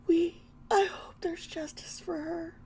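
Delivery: whispered through tears; barely voiced, grief choking the words; real whisper of grief; tearful whisper. Real, not acted. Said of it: "I am feeling the grief right now".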